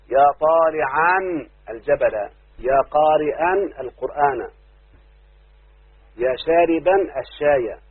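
slow, deliberate run of examples. Shown as a demonstration. Teacher listing examples to students, slowly stressing endings. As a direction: stretch each example so the nunation is heard. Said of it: "الشبيه بالمضاف يبقى منون".

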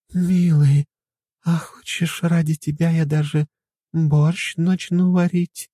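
slow, sultry breathy whisper; breathy Hollywood starlet. Acted. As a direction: purr like a femme fatale. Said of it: "томно прошептала". (томным шёпотом роковой женщины)

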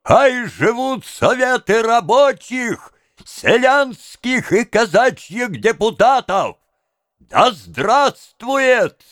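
high, slow rallying cries; orator's drawn-out exclamations; crying out slogans. A narrator's reading. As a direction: call it out high to a whole square. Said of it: "вскрикивал".

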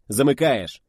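loud curt command; barked order. A narrator's reading. Narrator puts Volodya at the rear, barking. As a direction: bark it over your shoulder. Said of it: "рявкнул я, не оборачиваясь".

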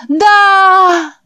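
very high, loud yes, yes; crowd shouting yes. Acted. The boys roar yes back at the preacher.

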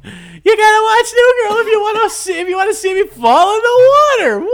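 loud showman principal. Pretend. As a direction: hype it like a goofy principal. Principal at dunk tank.

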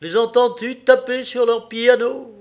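high, loud outburst; loud exclamation. A narrator's reading. Husband remarking on piano next door, loud exclamation. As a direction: exclaim over the racket.

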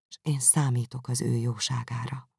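ordinary delivery

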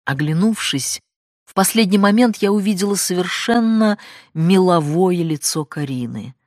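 usual speaking voice

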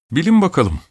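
high, playful challenge; a father's playful, high-pitched riddle; teasing guess-what. A narrator's reading.

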